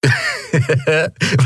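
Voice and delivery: amused laugh. A narrator's reading. Gunman laughing at an obvious bluff, amused. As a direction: laugh off the old trick. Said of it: "Er lachte".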